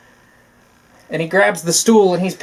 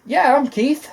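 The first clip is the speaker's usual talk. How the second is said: higher sly reply; lightly voiced eager brother; mock eager yes; 9 semitones higher, slower than usual. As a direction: play along slyly. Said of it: "he's like".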